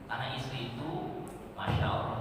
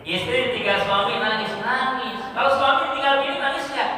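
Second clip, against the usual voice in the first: raised, high, quickening challenge; loud preaching question; 10 semitones higher, 9 dB louder than usual. Acted. Preacher teasing the congregation about husbands crying, loud and high. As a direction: fire the question at the crowd.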